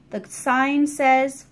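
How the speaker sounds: usual speaking voice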